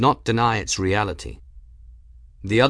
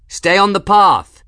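loud, raised, strained call back; croaked shout; 10 semitones higher, 9 dB louder than usual. A narrator's reading. Man guiding her through smoke, croaking a loud order back. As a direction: croak it out loudly over the smoke. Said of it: "he croaked back".

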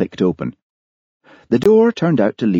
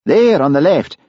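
sudden raised call; exclaiming; 11 semitones higher, 5 dB louder than usual. Acted. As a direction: point it out sharply. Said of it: "Then Hamish exclaimed".

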